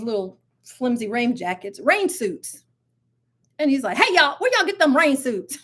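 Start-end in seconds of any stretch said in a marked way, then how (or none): 4.0-5.6 a touch higher Southern country twang; imitating the Southern country man again; Southern country accent. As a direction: repeat the Southern country man's call. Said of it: "he was so country".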